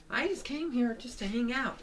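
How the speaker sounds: high-pitched casual reply; cartoon character voice. Character explaining why they came, high put-on voice.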